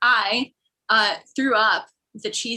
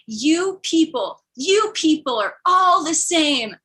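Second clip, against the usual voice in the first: slow, raised mock indignation; upset driver; 5 semitones higher, 4 dB louder, slower than usual. Immigrant driver blaming his passenger, slow mock indignation. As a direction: draw out the accusation, higher and louder. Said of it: "the driver just was very upset".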